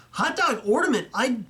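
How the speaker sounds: hot dog ornament? rises high in a genuine double take; the ornament catches him off guard and he re-reads it, high and astonished; surprised double take. Real, not acted.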